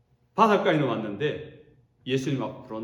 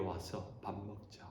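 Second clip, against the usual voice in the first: much softer, slow tender call; gentle invitation; 5 semitones lower, 15 dB quieter, slower than usual. Acted. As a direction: encourage them softly to come eat. Jesus voiced gently. (부드럽고 느린 격려의 부름)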